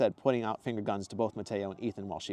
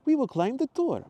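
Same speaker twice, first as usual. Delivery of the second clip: put-on Mateo's accent; 7 dB louder than usual. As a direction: gush over her in a put-on accent. Mateo voiced.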